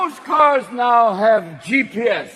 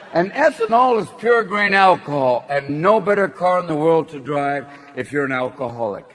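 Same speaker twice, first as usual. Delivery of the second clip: ordinary talk, nothing marked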